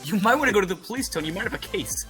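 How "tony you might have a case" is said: ordinary delivery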